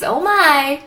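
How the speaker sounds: ordinary delivery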